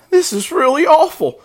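high, loud piglet wail; acted piglet close to tears; distressed young pig. A narrator's reading.

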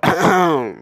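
sudden throat clearing out of nowhere; mock throat clear. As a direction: clear your throat like a disapproving machine. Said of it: "just clear they throat".

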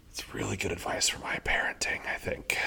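a quiet whisper tacked on at the end; whispered aside. Real, not acted. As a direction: drop to a whisper for the last thought.

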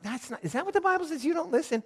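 very high-pitched mock disbelief; incredulous. Bible reader balking at a plain translation, high incredulous mockery. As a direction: question it in a squeaky, scandalized pitch.

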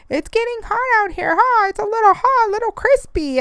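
put-on high, wavering old man voice; old man impression. Little old man at Sprouts chatting about the heat, high old voice. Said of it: "That's my impression of an old person".

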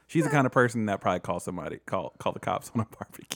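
ordinary talk

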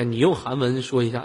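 usual speaking voice

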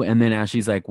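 ordinary delivery

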